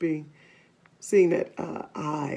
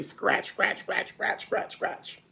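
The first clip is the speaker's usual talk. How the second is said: softly whispered scratch sounds, fading; 7 dB quieter, slower than usual. Acted. Narrator whispering the scratching sound to listeners, fading. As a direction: hush it like a quiet scratch.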